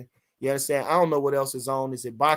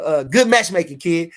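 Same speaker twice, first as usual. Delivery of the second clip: normal talking voice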